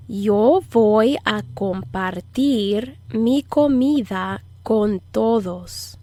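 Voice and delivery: slow, phrase-by-phrase Spanish model; measured, slow language-lesson delivery; slow teaching model. Shown as a demonstration. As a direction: stretch each word out for learners to follow. Teacher models sentence slowly to repeat.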